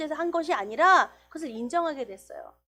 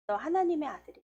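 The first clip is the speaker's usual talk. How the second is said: ordinary talk, nothing marked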